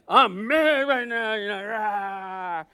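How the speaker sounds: loud, slow mock roar; hammed-up lion's roar of anger. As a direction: pound it out like a lion. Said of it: "pounds like a lion".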